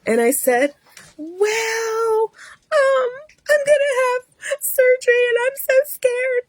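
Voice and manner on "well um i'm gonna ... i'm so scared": very high, tearful whimper; hammed-up crying through the words; mock sobbing. Scared patient telling nurse about surgery, mock sobbing. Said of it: "I'm crying".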